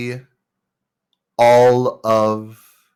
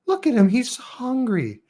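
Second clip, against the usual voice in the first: put-on pleading kid; 13 semitones higher, 3 dB quieter than usual. Kid pleading to keep cat.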